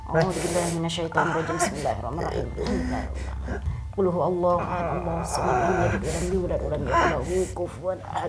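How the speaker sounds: steady chanted Quran recital; prayer recitation. Acted. Recites prayer over the woman.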